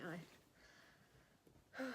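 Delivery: breathless talking; short of breath after walking. Real, not acted. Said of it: "I'm breathless".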